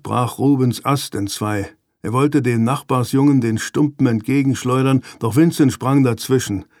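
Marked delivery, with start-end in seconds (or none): none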